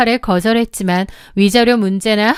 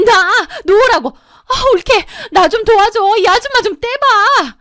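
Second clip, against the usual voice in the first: much higher, louder panicked pleading; desperate shrieking; 10 semitones higher, 3 dB louder than usual. A narrator's reading. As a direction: scream for help, frantic. Begging for help while grabbed. (머리채 잡혀 다급하게 도와달라 비명 지르는 목소리)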